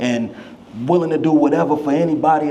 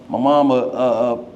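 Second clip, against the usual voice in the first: ordinary talk, nothing marked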